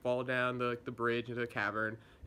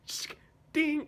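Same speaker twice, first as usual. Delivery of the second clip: click, then a high, loud ding; imitated clock sound; 3 dB louder than usual. Assassin starts her sixty-second clock, imitated click and ding. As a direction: mimic a timer clicking and chiming.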